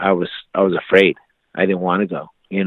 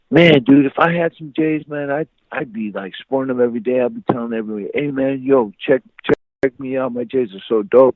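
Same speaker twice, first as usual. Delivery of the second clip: higher-pitched buddy showing off; excited bragging; 4 semitones higher than usual. Retold or imitated. Friend boasting about his imagined sneakers, hyped.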